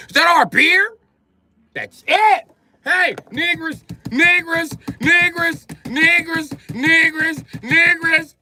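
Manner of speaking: very high, loud hollering call; mock outraged yelling. Acted colonists calling out angrily.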